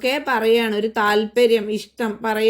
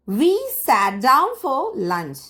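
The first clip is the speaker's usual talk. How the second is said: slow model reading; 5 semitones higher, much slower than usual. Shown as a demonstration. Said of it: "teacher models English sentence".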